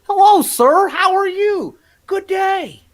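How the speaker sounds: very high, hammed-up mockery of an American accent; squeaky falsetto parody of American accents; mock accent impression. Host imagining Brits mocking Americans, silly accent in falsetto. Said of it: "making fun of American accents".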